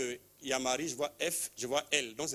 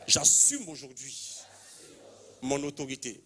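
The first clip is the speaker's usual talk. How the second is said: prayer declaration. Real, not acted. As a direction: lead the crowd line by line. Répétez après moi.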